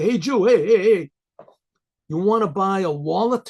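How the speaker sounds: loud mocking call of street hoodlums. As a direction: shout it out to grab his attention. Hoodlums calling out to him.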